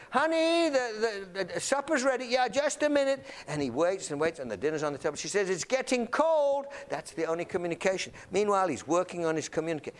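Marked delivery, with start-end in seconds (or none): 0.1-2.2 mock wifely shout, pitched well up; calling through. Wife calling husband away from his computer, acted shout. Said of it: "She shouts through". 2.2-3.3 raised-pitch, put-off reply; acted husband stalling from the computer; distracted call back. Husband answering wife's supper call, mock put-off shout. Husband fobs off calling wife. 5.5-6.7 high, stretched-out acted call; impatient call. Wife to husband as dinner waits, mock impatient calling.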